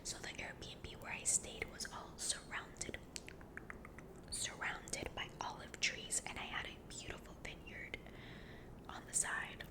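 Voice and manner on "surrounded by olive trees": ordinary delivery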